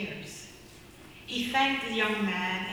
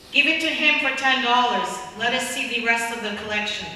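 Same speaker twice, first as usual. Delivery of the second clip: louder, a bidder calling out impatiently; impatient shout; 2 semitones higher, 8 dB louder than usual. Acted. Bidder in the crowd shouts at the auctioneer to hurry up.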